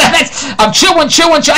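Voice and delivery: laughing through the words; chuckling while telling it. Real, not acted. Laughing at chewy steak.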